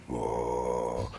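deep, soft otherworldly whoa; a low hushed voice from within; inner voice. Retold or imitated. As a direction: mystical inner voice rising up. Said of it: "all of a sudden I hear".

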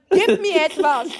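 high, loud mock demand. Demanding something from Sunny, high and loud. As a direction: bark it at him in jest. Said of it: "gib mir irgendetwas".

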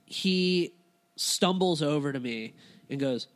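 ordinary delivery

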